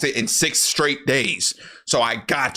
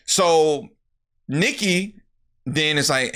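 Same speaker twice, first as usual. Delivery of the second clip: ordinary talk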